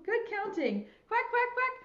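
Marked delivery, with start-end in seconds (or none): 1.1-1.7 high-pitched playful duck quacks; duck quacking. Pretend. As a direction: quack like a little duck for children. Voices ducks in counting game.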